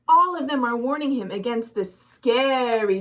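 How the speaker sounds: ordinary delivery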